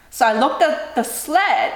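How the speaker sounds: ordinary delivery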